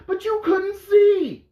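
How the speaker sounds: high, loud, drawn-out complaint; exasperated outburst. Real, not acted. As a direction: cry it out as the last straw. Climax of exasperated list of faults.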